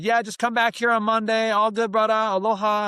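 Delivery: high, loud, breezy aloha voice; friendly island bank teller reassuring a broke newcomer; easygoing island local. Retold or imitated. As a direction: play the relaxed bank clerk waving off worry. Imitating the easygoing bank clerk.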